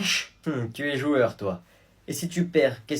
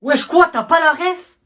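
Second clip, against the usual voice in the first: high, loud indignant cry; scandalised that he doesn't know; pitched-up loud outrage; outraged disbelief; 12 semitones higher, 11 dB louder than usual. Acted. Outraged at missing reference.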